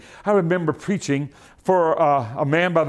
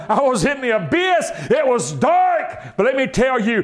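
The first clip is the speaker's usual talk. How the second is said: rising, fervent preacher's cry; full-force testimony from the pulpit; preaching; 6 semitones higher than usual. Real, not acted. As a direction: punch each phrase to its last word.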